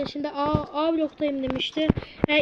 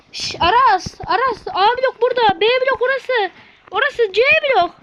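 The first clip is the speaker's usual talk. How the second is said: loud explaining; 8 semitones higher, 8 dB louder than usual. Real, not acted. Explaining blocks to friend loudly.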